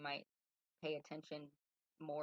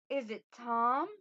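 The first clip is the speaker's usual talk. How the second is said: put-on higher-pitched young lady's voice; young queen, feminine; 8 semitones higher, 12 dB louder, slower than usual. Queen guesses the little man's name, feminine questioning voice. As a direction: ask it as a high, lilting guess. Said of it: "soft-spoken and".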